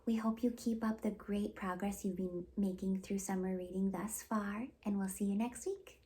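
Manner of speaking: usual speaking voice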